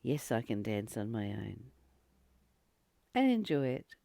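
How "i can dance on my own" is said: ordinary delivery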